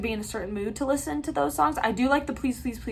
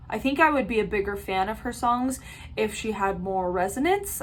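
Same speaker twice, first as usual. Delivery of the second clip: ordinary delivery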